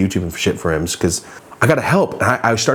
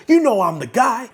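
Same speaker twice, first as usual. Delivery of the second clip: high, boastful voice; imitating George; 13 semitones higher than usual. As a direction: brag like George, pitched way up. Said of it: "You just referred to yourself in third person".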